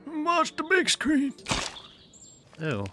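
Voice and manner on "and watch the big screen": hammed-up grandpa telling about the old days; mock old-timer. Gamer hamming up an old-timer's memories of drive-ins.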